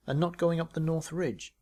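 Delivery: ordinary delivery, nothing marked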